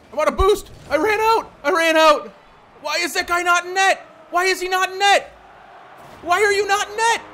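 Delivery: loud, high, exasperated yelling; angry outburst. Real, not acted. Player berating teammate for leaving net, angry shout. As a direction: yell in real disbelief, pitch riding high.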